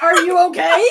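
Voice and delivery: friends' baffled British voice, high and loud, laughing; mock British. Friends reacting to sudden British, mock British laughing. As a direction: ham up a posh British query through a laugh. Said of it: "they're gonna be like".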